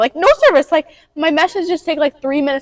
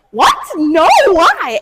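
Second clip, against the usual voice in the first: loud, higher startled retort; shocked; 4 semitones higher, 6 dB louder, much slower than usual. Acted. Daughter answering mom's Texas question, shocked. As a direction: blurt it out in disbelief.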